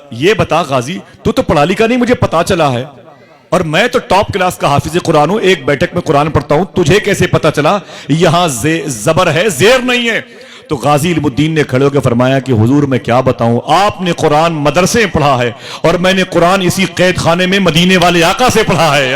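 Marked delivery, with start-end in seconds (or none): 0.1-10.2 amazed challenge. Retold or imitated. Saint questions Ghazi, amazed. 12.5-19.1 fervent declaration. Retold or imitated. Ghazi's fervent reply.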